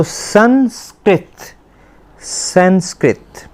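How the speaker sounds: संस्कृत drawn out slowly as sans-krit; slow model pronunciation. Shown as a demonstration. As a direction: repeat संस्कृत slowly for students. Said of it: "इसको संस्कृत नहीं पढ़ना".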